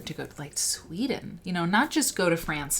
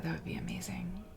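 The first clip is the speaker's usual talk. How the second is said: whispered wish; 10 dB quieter than usual. Real, not acted. Dreamy aside about South Korea.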